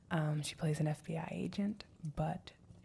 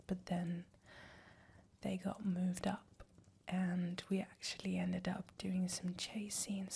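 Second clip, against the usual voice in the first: put-on British accent. Staying in character to tease partner, whispered British accent. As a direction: play a flirty Englishwoman in a whisper. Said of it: "with a British accent".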